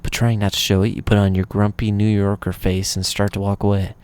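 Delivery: usual speaking voice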